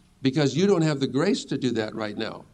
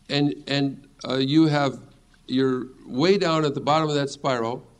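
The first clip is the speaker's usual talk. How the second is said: normal talking voice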